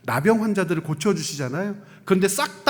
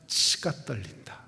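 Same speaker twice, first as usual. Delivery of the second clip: seething whisper; 8 semitones lower, 8 dB quieter than usual. Shown as a demonstration. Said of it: "정말 화날 때 어떤 말".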